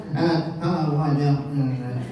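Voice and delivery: mock halting ah-ah sounds with a laugh; playful ah-ah-ah stutter; laughing imitation of a stammer. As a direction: stammer it out like a shy kid, laughing. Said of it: "si Chelsea, pag nakaka".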